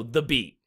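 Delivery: "the" light, stress landing on "beat"; iambic stress. Shown as a demonstration. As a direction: say "the" unstressed, then hit "beat". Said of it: "unstressed syllable and then stressed syllable".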